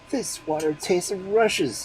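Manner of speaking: high, slow complaint; complaining exclamation. A narrator's reading. Husband rejecting water, a slow high complaint. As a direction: grumble about the taste. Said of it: "he exclaimed".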